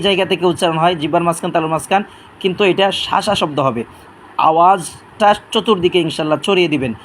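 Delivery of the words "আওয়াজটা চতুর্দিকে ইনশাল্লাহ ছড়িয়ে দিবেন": ordinary talk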